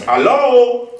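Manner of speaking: "Hello" called out very high and loud; call to the crowd. Shown as a demonstration. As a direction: lead the audience with a high ringing call. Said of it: "Okay, can we do this".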